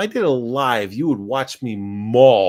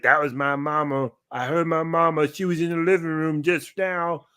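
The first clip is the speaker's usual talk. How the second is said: lightly voiced dog; 5 semitones higher than usual. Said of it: "he's like".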